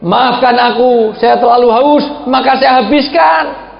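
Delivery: high, slow pleading apology; husband apologising to his wife for finishing the drink; apologetic husband. Acted. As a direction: apologise slowly and earnestly. Prophet apologises to Aisyah.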